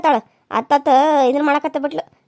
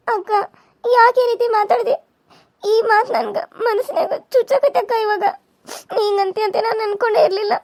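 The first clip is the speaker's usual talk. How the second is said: wounded by her sister's words, crying; hurt crying; 6 semitones higher than usual. Acted. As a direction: voice breaking into tears as she pleads. Hurt girl answers sister's insult.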